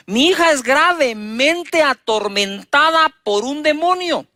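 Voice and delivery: slow, lifted plea of a desperate mother; a mother pleading with Jesus, drawn out. Acted. Woman begging Jesus for daughter.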